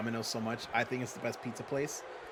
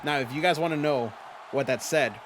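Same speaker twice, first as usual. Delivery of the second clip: ordinary talk, nothing marked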